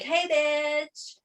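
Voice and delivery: high, drawn-out mock call-out; mock greeting. Imagining the man in neon greeting his victim, mock call-out. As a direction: call out a cheeky hello. Voicing the sneaky man's greeting.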